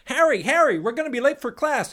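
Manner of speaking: high excitable schoolkid; squeaky schoolkid voice. Retold or imitated. Voicing Harry Potter friend.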